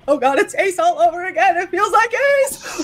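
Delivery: high-pitched, wailing retold panic; mock panic. Coach retelling fear of the wheels coming off, high mock panic. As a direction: act out freaking out as it all falls apart.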